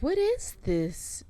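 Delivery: quoting mom, slow and high-pitched; mom's startled voice. Retold or imitated. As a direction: put on mom's baffled tone. Mom's voice quoted.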